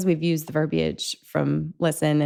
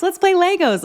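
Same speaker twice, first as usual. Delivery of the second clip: bright distraction; 12 semitones higher, 3 dB louder than usual. Retold or imitated. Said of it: "And I'm like".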